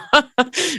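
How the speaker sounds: sudden high-pitched laugh; a real laugh breaking out; burst of high laughter. Real, not acted. Laughs at own story.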